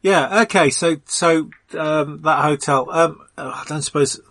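ordinary talk, nothing marked

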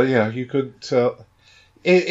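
ordinary delivery

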